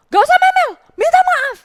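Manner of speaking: very high, loud cutting-off shout; loud angry mother; furious command. Retold or imitated. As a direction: shut the kid down hard.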